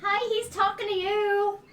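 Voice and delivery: loud, high call to get attention. Acted. Calling to a stranger who ignored the question, loud and high. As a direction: raise the voice to reach an oblivious stranger.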